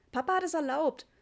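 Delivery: hasty, high-voiced justification; quick defence. A narrator's reading. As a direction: get it in fast before anyone objects. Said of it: "sagte Jule schnell". (schnell und hoch herausgeplatzt)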